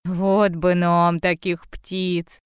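low, slow little bear voice; drawling wistful bear cub. A narrator's reading. Bear cub wishing for spring birds, low wistful drawl. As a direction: draw it out low and longingly. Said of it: "протянул медвежонок".

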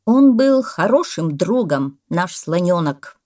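slow, loud eulogy; solemn farewell. A narrator's reading. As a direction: pronounce it like a tribute to a lost friend. Parrot's mock farewell speech. (торжественное прощание попугая)

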